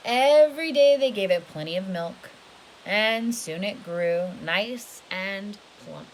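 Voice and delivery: lively storybook read, rising and falling; storybook voice for a little one; reading to children. A narrator's reading. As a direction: read it big and animated for a child.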